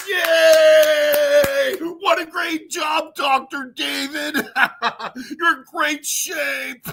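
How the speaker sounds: high, loud mock cheer with a laugh; hammed-up gushing praise; mock cheering fan. Mocking the crowd that cheers Doctor David. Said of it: "and it's like".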